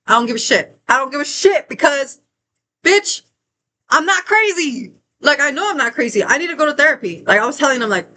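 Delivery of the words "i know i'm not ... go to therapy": ordinary talk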